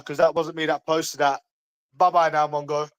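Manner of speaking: ordinary talk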